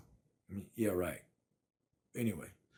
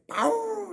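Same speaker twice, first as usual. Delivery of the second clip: very high yelping howl; mock puppy howl; imitated dog howl; 6 dB louder than usual. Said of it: "That first one's a howl".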